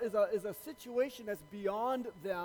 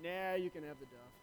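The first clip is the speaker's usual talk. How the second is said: low, soft farmer punchline; deadpan old farmer, voice dropped; 5 semitones lower, 8 dB quieter than usual. Acted. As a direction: toss it away quietly as the farmer. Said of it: "And the farmer says".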